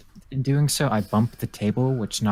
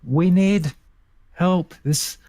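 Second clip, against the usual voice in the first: high, raised call for help; yelling out; 9 semitones higher, 4 dB louder, slower than usual. Acted. Player's character yells for aid as boss collapses, yelling out. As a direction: call urgently across the room. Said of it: "I yell out".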